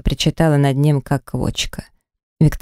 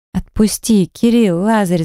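mild higher-pitched cry for release; 5 semitones higher, slower than usual. A narrator's reading. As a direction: call out in alarm, not at full volume. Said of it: "закричала я". (негромкий вскрик)

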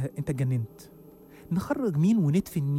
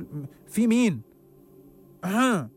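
slow, drawn-out shout with forced courage; fake brave yell; 3 semitones higher, 5 dB louder, much slower than usual. Man shouting into the dark storeroom, fake-brave yelling. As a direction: call out to hide the fear. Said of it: "وبدأت أزعق بهستيريا وشجاعة مصطنعة".

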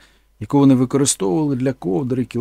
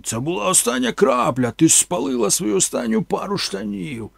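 higher, raised voice in a rage; angry boss; 5 semitones higher than usual. Acted. Boss scolds mama for burning trousers, raised anger. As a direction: bark the accusation at her. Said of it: "кричав він".

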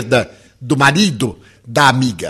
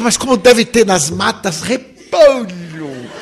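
higher, slow, amused puzzlement; naive wonder, laughing; 4 semitones higher, 3 dB louder, slower than usual. Retold or imitated. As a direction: marvel naively at cabbages in the woods, half laughing. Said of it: "Quer dizer".

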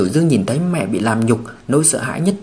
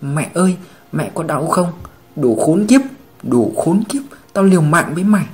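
put-on raised, distressed boy's outcry, rising into cursing; anguished cry; 3 semitones higher, 3 dB louder than usual. A narrator's reading. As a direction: cry out for mother, then curse her attackers. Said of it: "lòng đau xót hét lên".

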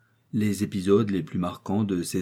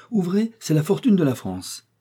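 gaily calling; 9 semitones higher, 3 dB louder than usual. A narrator's reading. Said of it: "dit gaiement".

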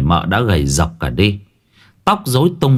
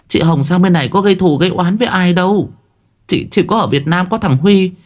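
higher, quick worried pleading; anxious young woman; 9 semitones higher than usual. A narrator's reading. Quyên pleads with the master, high and fast. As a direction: rush it high like a frightened girl.

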